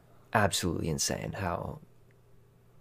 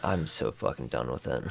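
weary disgust; 7 semitones lower, 4 dB quieter than usual. Real, not acted. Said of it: "You sound really fed up with it".

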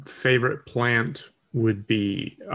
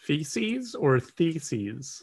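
slow, questioning; 3 semitones higher, much slower than usual. Real, not acted.

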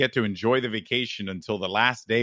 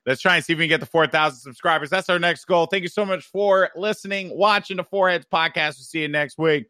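podcast outro; 6 semitones higher, 4 dB louder than usual. Real, not acted. Outro sign-off.

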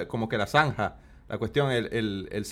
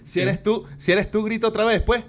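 higher, louder call toward the street; 7 semitones higher, 5 dB louder than usual. Real, not acted. Calling out from the kitchen to the stranger, loud. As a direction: shout back to find the caller.

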